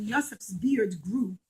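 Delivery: ordinary delivery, nothing marked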